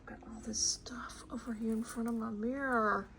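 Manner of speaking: soft whispered aside. Real, not acted. Showing viewers the stuff by her mirror, whispering. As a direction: whisper it as if not to disturb anyone.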